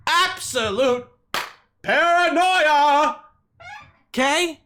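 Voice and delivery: drawn-out, high, loud cry of paranoia; slow, booming, theatrical announcement; dramatic exclamation. Acted. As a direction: stretch it out like a horror reveal. Mock-dramatic reveal of paranoia.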